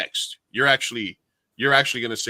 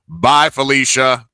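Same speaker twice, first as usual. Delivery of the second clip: louder, slow, drawn-out farewell; loud mic send-off; 11 dB louder, slower than usual. Retold or imitated. Bartender booming over the mic at the exiting customer, louder. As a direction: boom it over the mic as they leave. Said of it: "I just got the mic real loud".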